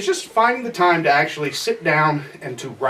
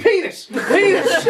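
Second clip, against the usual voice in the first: blurted outburst; 11 semitones higher, slower than usual. Acted. Fake Freudian slip outburst.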